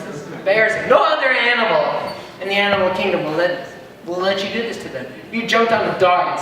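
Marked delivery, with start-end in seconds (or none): none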